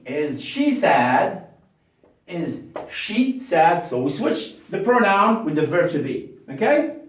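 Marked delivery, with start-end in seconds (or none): 0.1-1.5 is she sad, slow and deliberate; slow question drill; slow question model. Shown as a demonstration. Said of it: "modelling question". 2.3-3.8 Is she sad, slow with falling end; slow repeat. Shown as a demonstration. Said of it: "modelling question".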